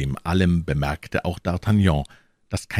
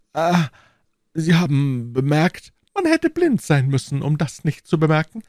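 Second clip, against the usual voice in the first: high-pitched, put-on awkwardness; feigned embarrassment; 10 semitones higher, 4 dB louder than usual. A narrator's reading. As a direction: pretend to be caught out. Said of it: "als sei er verlegen". (gespielt verlegen, mit hoher Stimme)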